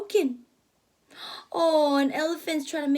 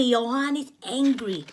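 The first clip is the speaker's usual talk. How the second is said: usual speaking voice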